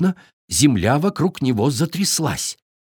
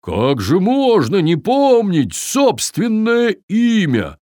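surprised; 7 semitones higher, 3 dB louder, slower than usual. A narrator's reading. Viking amazed.

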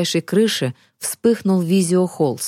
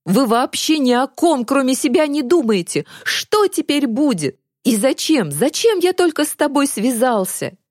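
higher-pitched, accusing outburst; angry reproach; 6 semitones higher than usual. A narrator's reading. Boy blaming the girl he got mixed up with, angry reproach. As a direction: complain bitterly in a raised pitch. Zakhar's angry tirade at Varya.

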